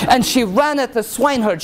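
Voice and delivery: ordinary delivery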